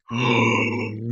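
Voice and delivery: sharp theatrical gasp of outrage; mock gasp. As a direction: gasp as if scandalised. Retold customer service fury.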